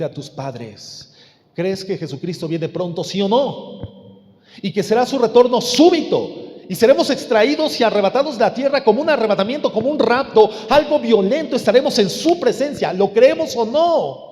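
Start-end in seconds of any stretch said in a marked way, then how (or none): 4.6-14.3 loud, high-pitched pulpit preaching; impassioned preaching. Real, not acted. Preacher proclaims the sudden return of Christ, impassioned preaching. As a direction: drive each phrase home to the congregation.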